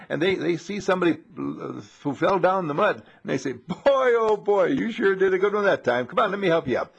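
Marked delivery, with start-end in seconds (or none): 3.5-6.9 high, loud jolly helper voice; cheerful helper. Retold or imitated. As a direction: beam at the muddy man.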